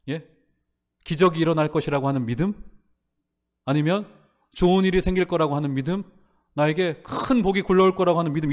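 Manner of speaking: ordinary delivery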